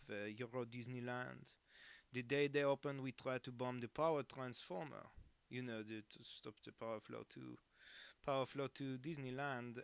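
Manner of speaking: hesitant, improvised French accent; bad French accent. Shown as a demonstration. Frenchman confesses to a bungled bombing, in a bad French accent. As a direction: put on a halting mock French accent. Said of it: "Can I do a French accent?".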